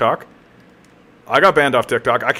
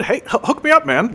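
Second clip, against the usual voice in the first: much higher, eager begging voice; mock pleading; 11 semitones higher than usual. Real, not acted. Asking the chatter for a Hollywood hookup, high pleading. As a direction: squeak out a plea for a favour.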